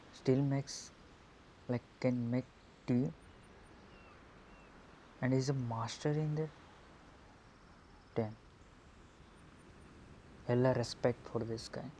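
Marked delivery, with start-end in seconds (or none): none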